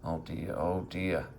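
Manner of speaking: usual speaking voice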